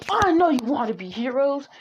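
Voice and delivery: acted cheer, rising into an "ah"; joyful teacher. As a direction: toss it out gleefully to the class. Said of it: "in a joyful way".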